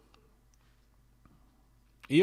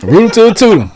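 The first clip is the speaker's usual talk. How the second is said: loud repeat; 8 semitones higher, 19 dB louder, faster than usual. Shown as a demonstration. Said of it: "is that the same?".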